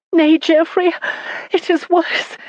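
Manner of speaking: high, tearful voice breaking; sobbing. A narrator's reading. Wife sobbing to her husband about his drowned vassal. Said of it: "she said, sobbing".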